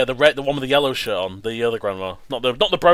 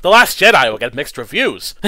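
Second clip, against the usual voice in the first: ordinary talk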